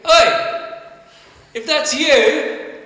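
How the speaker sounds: high, loud yell across the water; Peter calling out. Acted. Peter calls to Jesus on the water, loud and high shout. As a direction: shout out boldly to someone far off. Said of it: "And Peter, being Peter, what does he do?".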